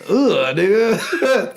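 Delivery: high, slow drawn-out disgusted groan; a lightly voiced stern headmistress; Miss Trunchbull. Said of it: "Sound like Miss Trenchbolt from Matilda".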